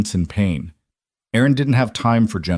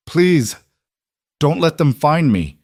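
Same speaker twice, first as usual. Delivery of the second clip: high, pleading, terrified; hoarse, terrified plea; 7 semitones higher, 3 dB louder than usual. Acted. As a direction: beg in fear, voice cracking. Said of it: "hoarse, barely audible, soaked in fear".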